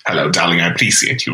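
low, lightly voiced fashion diva; a hint of a haughty designer; Edna Mode voice. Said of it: "Edna. Mode".

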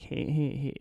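high, squealy man's voice; Mike Tyson. Retold or imitated. Showing the caller's voice, high-pitched and squealy. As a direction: imitate a squeaky celebrity, softly and badly. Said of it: "high pitched and kinda squealy".

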